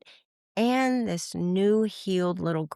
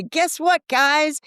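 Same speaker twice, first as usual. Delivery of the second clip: high, loud, bubbly call to the crew; bright high call of guess what; excited announcement; 10 semitones higher, 5 dB louder, much slower than usual. Retold or imitated. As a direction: announce it like great news. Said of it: "I'd say".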